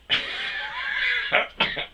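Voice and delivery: high-pitched burst of laughter; real amused laugh. Real, not acted. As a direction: laugh out in disbelief. Laughing at repeated taunt.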